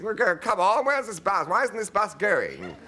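high, loud, exasperated complaint; impatient passengers. Retold or imitated. Passengers on the stopped bus, impatient and loud. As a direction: grumble at the stalled bus.

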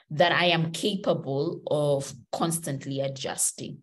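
ordinary talk, nothing marked

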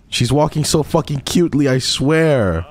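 high, excited squeal; high-pitched adoring outburst; overwhelmed gushing. Real, not acted. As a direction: cry out at unbearable cuteness. Gushing over pet's walk.

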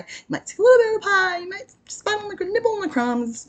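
high, fast squeaky voice; squeaky little voice. Pretend. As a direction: nibble it in a tiny high voice. Playful high voice.

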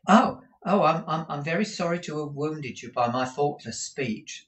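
high, stammering apology; flustered apology. A narrator's reading. Companion sorry to have wounded Kate, flustered and high.

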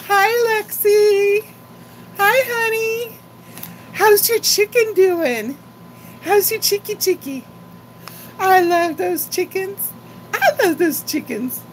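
put-on high, bright baby-talk to a grandchild; high animated grandma voice talking to a granddaughter on a call. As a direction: coo up high at the little one. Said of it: "really animated voice".

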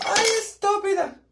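very high, drawn-out playful squeal; mock insult. Teasing a viewer with a squealed mock insult.

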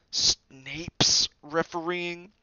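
slow, high, loud incredulous splutter; aghast, drawn-out question; spluttering disbelief. A narrator's reading. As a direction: splutter it out in shock. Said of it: "he spluttered through a mouthful of mud".

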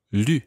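lu with a tight, fronted U; French U vowel. Shown as a demonstration. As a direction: isolate "lu" to show the French U. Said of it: "It's a completely different vowel sound".

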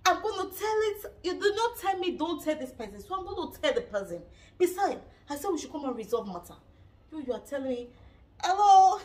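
heated retort. Retold or imitated. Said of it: "She said".

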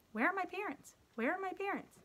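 high-pitched, anxious call for parents; retold panic. Stage fright, looking for parents.

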